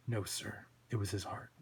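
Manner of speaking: low, very soft correction; understated, obedient sidekick; meek deputy Twitch. Acted. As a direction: correct the boss quietly.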